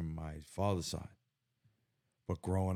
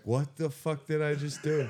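higher-pitched mock dismay, laughing; retold disbelief; 8 semitones higher, 4 dB louder than usual. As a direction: exclaim it like a comic realization. Retold shocked regret after reading Bible.

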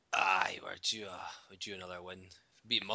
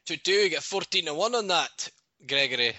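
louder, higher cheesy betting-ad voice; hammed-up fake Scottish bookmaker announcer; mock bookie ad voice; 8 semitones higher, 9 dB louder than usual. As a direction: sell the odds like a cheesy bookie advert. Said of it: "Is that the, the voice-activated McBookie there?".

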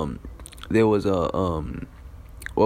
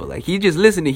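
normal talking voice

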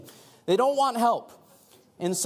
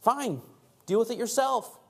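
raised, huffy acted dismissal; indignant; 7 semitones higher than usual. Acting a snubbed helper giving up, mock indignant. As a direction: wash your hands of them, mildly indignant. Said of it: "sometimes I get a little indignant".

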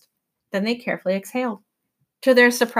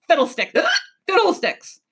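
vexed outburst broken by a hiccup; grumbled exclamation; 8 semitones higher than usual. A narrator's reading. Professor exasperated amid the din, grumbling. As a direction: grumble it, pitch jumping on the repeat. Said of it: "the professor grumbled".

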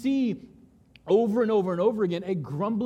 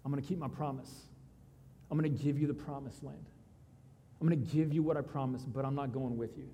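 quiet God voice; 5 semitones lower, 8 dB quieter, faster than usual. Retold or imitated. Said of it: "God tells them still".

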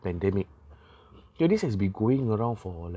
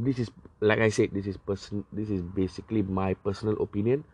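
normal talking voice

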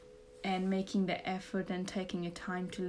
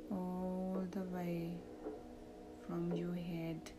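soft, slow meditation voice; calm, hushed, lead them into relaxation; guided meditation; 8 dB quieter than usual. Acted.

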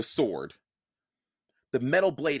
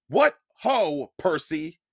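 shouted; 2 semitones higher, 5 dB louder, slower than usual. Acted. Teacher tosses Percy a pen, loud call. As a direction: call out across the gallery, bright. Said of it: "he shouted".

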